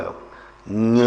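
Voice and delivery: ञ ending with nasal push; ञ with nasal finish; nasal release. Shown as a demonstration. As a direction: close ञ through the nose. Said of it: "नासिका पे जोर पड़ेगा".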